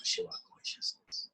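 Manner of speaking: breathy whispered call to Shiva; whispered invocation. Acted. Teacher whispering the prayer to the group, hushed. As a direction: whisper the name like a secret prayer.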